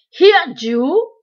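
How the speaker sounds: mock the helpless man as you kick; cruel taunt. A narrator's reading. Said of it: "I heard one of them say".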